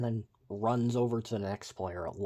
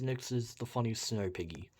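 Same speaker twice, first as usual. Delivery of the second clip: ordinary talk, nothing marked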